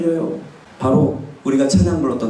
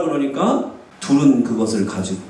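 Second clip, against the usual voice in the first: ordinary delivery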